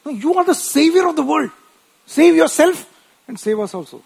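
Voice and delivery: high, loud taunting plea; mocking thief. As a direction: sneer like the thief on the cross. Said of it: "one thief said".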